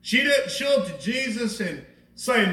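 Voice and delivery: ordinary talk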